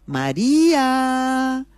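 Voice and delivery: a long, sing-song call down the hall; slow drawn-out call. Acted. Husband at the kitchen door calls his wife, slow drawn-out call. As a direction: stretch María out very slowly in a high call.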